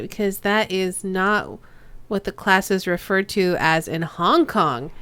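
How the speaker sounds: usual speaking voice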